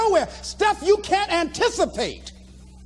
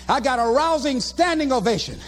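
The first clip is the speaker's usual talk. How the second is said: ordinary talk, nothing marked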